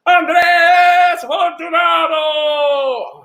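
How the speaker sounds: high, drawn-out shouted winner reveal; announcer's shout. Shown as a demonstration. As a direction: belt out the name with huge theatrical flourish. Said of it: "just so I could do that".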